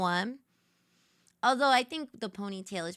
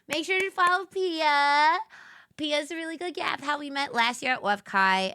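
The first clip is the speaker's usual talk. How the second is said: ordinary talk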